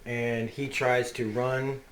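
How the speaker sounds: ordinary talk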